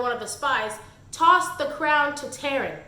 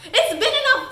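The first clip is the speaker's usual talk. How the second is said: much higher, loud, cracking with laughter; incredulous laughing peak; 10 semitones higher, 5 dB louder than usual. Real, not acted. Mocking his late rescue, loud high laughing outrage. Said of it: "oh, it's enough".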